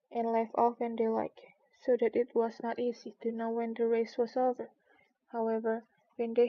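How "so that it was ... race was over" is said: usual speaking voice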